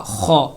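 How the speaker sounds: خو said thick and heavy from the upper throat; heavy kho. Shown as a demonstration. Teacher demonstrating a heavy خو. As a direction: give خو a full, heavy throaty sound. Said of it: "اس کو موٹا کر کے پڑھا جائے گا".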